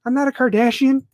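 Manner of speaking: ordinary talk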